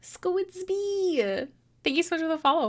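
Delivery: high, bright cheer for a new follower; excited thanks. Real, not acted. Streamer cheering Squidsby for following the stream, excited high voice. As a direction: greet the name with a happy squeal.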